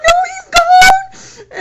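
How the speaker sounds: very high, loud sobbing panic; high pitched crying. Retold or imitated. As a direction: wail it high and tearful, barely getting words out. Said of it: "real high pitched tone and me crying".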